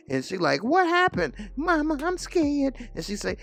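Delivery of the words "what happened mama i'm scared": a scared young girl blurting bad news; frightened girl. Daughter runs in and tells Bumpy in a high panicked voice.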